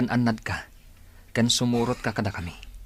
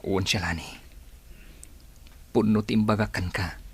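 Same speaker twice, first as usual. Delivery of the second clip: ordinary talk, nothing marked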